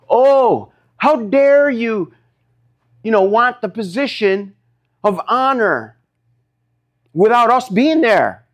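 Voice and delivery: acted high-pitched outrage; mock indignation. Preacher voicing ten disciples resenting James and John, indignant. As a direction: play affronted, dragging out the accusation. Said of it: "They're mad".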